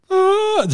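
a high squeaky "Ah" of disbelief; mock gasp. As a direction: gasp up high, caught off guard. Both lost it laughing.

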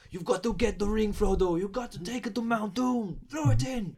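lightly Irish Arnie impression; Irish-sounding Arnie. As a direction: Austrian Arnie that slips into Irish.

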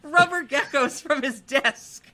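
high, loud, tickled delivery; laughing. Real, not acted. Host tells of rubber geckos in the desk, laughing. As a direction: crack up while telling it.

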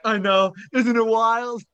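high, loud, gleeful quote; mock excitement. Retelling the other guy's answer to the agent, mock excitement. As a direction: play an amazed sidekick, pitch leaping up. Acted reply of the other robber.

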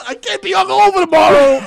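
laughing yell about tomorrow's hangover; laughing shout. Real, not acted. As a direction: blurt it out through laughter. Laughing loud outburst.

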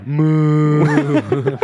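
loud long ghostly moo; haunting drawn-out moo; ghost cow moo. Acted. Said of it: "Ghost cow".

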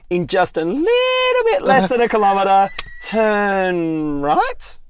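high, loud announcer directions; satnav voice. Acted. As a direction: call out turns like a GPS. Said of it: "mine says things like".

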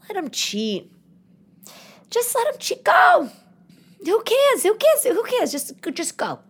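mock flippant. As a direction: toss it off like a spoiled socialite who shrugs. Sarcastic advice to cheated billionaire wife.

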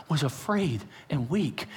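ordinary talk, nothing marked

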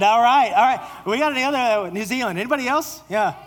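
high, loud playful calling out; excited banter. Real, not acted. Preacher joking with the congregation about countries, loud and excited. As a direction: hype the crowd up.

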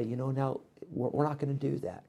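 hushed parental correction; soft calm voice. Shown as a demonstration. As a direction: speak softly like this to your kids. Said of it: "you can talk like this".

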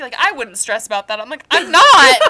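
fast, loud laughing retelling. Real, not acted. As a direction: laugh as you tell it.

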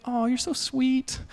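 a light, teasing take on the wife's gushing; imitating his wife. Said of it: "That's exactly what she sounds like".

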